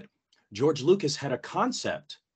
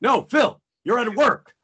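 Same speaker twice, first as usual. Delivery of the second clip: higher, louder self-reprimand; mock scolding; 9 semitones higher, 6 dB louder than usual. Actor telling himself to focus at work, mock scolding. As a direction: snap yourself back to attention.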